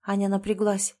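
ordinary delivery

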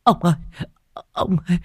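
high, panicked cry to her husband; cling to him and cry out in terror; wife shrieking that the child is laughing again; terrified wife. A narrator's reading. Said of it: "kinh hoàng".

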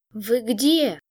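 muffled, strangled question. A narrator's reading. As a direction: call out as if smothered. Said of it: "придушённый голос демона".

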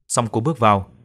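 ordinary delivery

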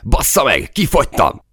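high-pitched cursing yell; alarmed shout. A narrator's reading. Soldier out of ammo in battle, alarmed shout. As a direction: yell it in frantic frustration.

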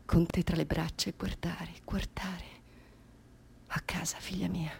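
tender whisper. Acted. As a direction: cradle the words. (sussurro tenero alla figlia)

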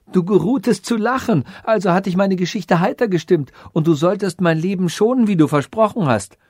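high-pitched plea for mercy; urgent pleading cry. A narrator's reading. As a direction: plead for your life. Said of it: "rief der Weise".

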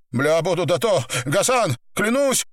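high, desperate swearing of innocence; muttered oath. A narrator's reading. Pinned man swears to his captors, high desperate muttering. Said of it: "бормотал Апельсин".

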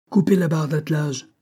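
high-pitched urgent command; shouted order. A narrator's reading. Banks ordering the second carriage cut loose, urgent call.

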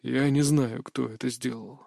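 quiet, fading to almost nothing. Acted. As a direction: answer softly and trail off. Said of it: "тихо ответил Шойс".

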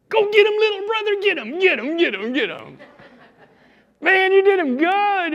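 very high, loud squealed rooting; wild cheering. Acted. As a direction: cheer your little brother on at full pitch. David's brothers cheering him on.

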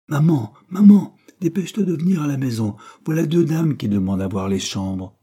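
put-on high, loud child calling out, settling as the news follows; excited calling. A narrator's reading. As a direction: call out to mother with excitement. Excited child calls mother home.

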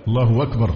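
أكبر losing its hamza to a w; intruded waw. Shown as a demonstration. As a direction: say الله أكبر as "Allahu-wakbar". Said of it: "كأن في واو". (إبدال همزة "أكبر" واوًا)

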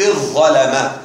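اظ ظلم slow, with one long doubled ẓ; merged doubled za. Shown as a demonstration. Teacher showing assimilation of za into za, slow doubled pronunciation. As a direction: say اظ ظلم as iẓẓalama. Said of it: "وأن تضغم الظاء في".

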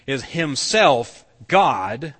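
"himself" and "God" drawn out loud and slow; slow, forceful emphasis on "himself" and "God"; emphatic stress on himself. Retold or imitated. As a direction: land heavily on "himself", pause, then hit "God". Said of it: "it's making it emphatic".

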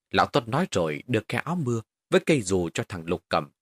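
plain reading voice

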